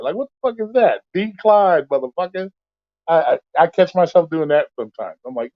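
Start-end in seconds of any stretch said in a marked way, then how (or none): 0.2-2.5 much higher, incredulous yell; high disgusted rant at a bad order; yelling at the phone. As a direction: bark at the screen like it insulted you. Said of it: "I'll be yelling at the phone".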